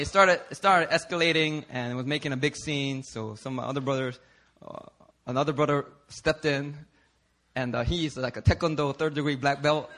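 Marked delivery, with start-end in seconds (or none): none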